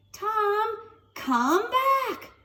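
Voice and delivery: high crying call. Acted. Stella calling Tom back, high cry. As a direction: shout him back across the lawn.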